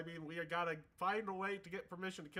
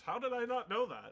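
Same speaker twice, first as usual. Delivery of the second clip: ordinary delivery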